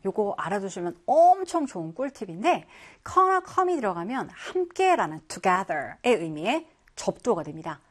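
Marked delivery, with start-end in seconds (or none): none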